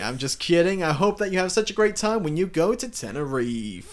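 high, loud mock DJ send-off; airport radio DJ. Pretend DJ wishing the couple a great trip, hammed-up radio voice. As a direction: gush at listeners like a radio host. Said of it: "a radio D J for an airport".

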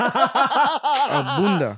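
laughing. Real, not acted. Drawn-out laughing echo.

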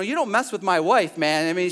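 ordinary delivery

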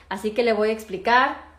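ordinary talk, nothing marked

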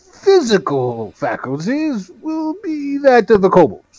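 high-pitched little gnome; a squeaky, self-important gnome. Acted. GM keeps gnome voice.

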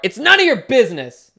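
high, loud angry mom; angry mother. Acted. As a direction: snap it like a furious mother. Mother snaps at the ghost horse.

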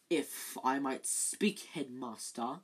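silky Snape. Acted. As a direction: purr it from the shadows. Snape voiced slow, low.

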